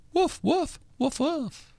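very high-pitched mock woofs; playful dog barks. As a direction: bark along like a tiny dog. Barking along to the song hook.

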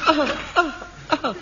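fake cough. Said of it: "You call that a cough?".

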